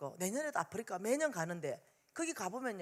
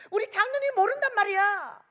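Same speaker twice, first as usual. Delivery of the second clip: acted high-pitched, loud wail of protest; frantic protest; 11 semitones higher, 7 dB louder than usual. Friend protesting that the elder husband doesn't know, imitated frantic cry.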